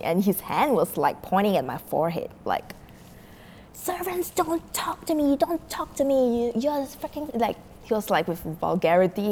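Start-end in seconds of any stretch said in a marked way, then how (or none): 3.7-7.3 high-pitched haughty scolding, not truly shouted; mock yelling customer. Customer dismissing servants, mock angry. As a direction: imitate someone indignantly silencing an underling. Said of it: "he was yelling at the top of his lungs".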